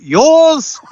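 high-pitched, drawn-out call of "Yours!"; mock yell. Warriors calling off a high ball, mock yell. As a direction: call it out high and loud, falling at the end. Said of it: "gonna be yelling out".

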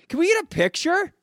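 high, loud, eager fan squeal; eager fan ask. Retold or imitated. Fan asking players for a picture, eager squeal. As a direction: beg for the picture like a giddy fan.

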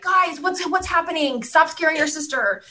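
high mock mom voice breaking it up; sing-song parent telling them off; mom scolding kids. Mom opening the bathroom door on her daughters, mock scolding.

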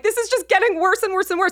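high, mock-despairing whine; mortified. Patient being hugged by laughing nurse, mortified. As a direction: pitch it up in comic embarrassment.

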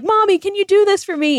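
put-on high whiny child asking mom; mock kid voice. Mom retelling her kids begging, in a high childish voice. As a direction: squeak it like a needy little kid. Kids' demands retold in story.

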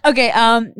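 ordinary delivery

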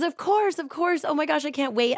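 high, thrilled and overjoyed; wife telling her parents yes, squealing with joy; gushing excitement. Retold or imitated. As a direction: gush with delight like great news just landed. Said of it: "she's just so grateful and excited".

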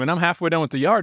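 ordinary talk, nothing marked

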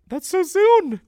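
squeaky high shocked cry; 1 shocked squeal. Real, not acted. Host reacting to news, high surprised squeal. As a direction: shriek it in disbelief.

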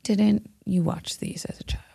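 unvoiced, hushed question; quiet whispered aside. Real, not acted. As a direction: whisper it like a secret.

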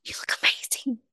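soft unvoiced whisper of you look amazing; whispered compliment. Real, not acted. Whispering reassurance to her friend about her looks. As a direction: whisper it warmly so only she hears.

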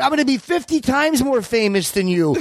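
high, smug mock tough-guy boast, stress on fifty; De Niro impression. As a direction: gloat like a movie star. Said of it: "like De Niro was telling fucking Jerry".

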